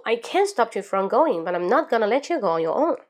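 rapid linked read with no pauses; fastest, in one breath. Shown as a demonstration. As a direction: take a breath and race to the end. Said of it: "最后一遍，深吸一口气，最快". (深吸一口气，最快速一气读完)

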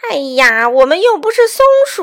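loud protest climbing very high; scoffing shout. A narrator's reading. Weasels rejecting nuts and mushrooms, scoffing shout. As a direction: bellow it in mocking disbelief.

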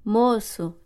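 ordinary talk

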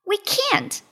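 high little rabbit voice; Clever Rabbit. A narrator's reading. Rabbit refuses the drowning lion, high voice. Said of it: "said Clever Rabbit".

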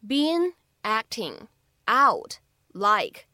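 "been acting out like" slowly, word by word; "been acting out like" in isolated words. Shown as a demonstration. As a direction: separate "been", "acting", "out", "like" with pauses. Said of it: "这样的四个单词呢".

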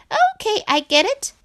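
high, loud impatient frog cutting in. A narrator's reading. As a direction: interrupt sharply in a high frog voice. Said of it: "said Frog impatiently".

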